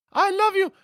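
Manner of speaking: high lady voice. Retold or imitated. Husband cooing to wife in a lady voice. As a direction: coo it like a sweet lady. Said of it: "I gave him the lady voice".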